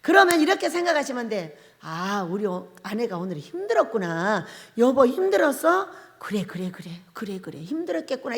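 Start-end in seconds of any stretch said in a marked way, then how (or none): 1.8-8.3 soft, gentle comforting; calm the tired wife softly; softly reassuring a worn-out wife; soothing husband. Shown as a demonstration. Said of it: "이렇게 말해주면 되는 거예요".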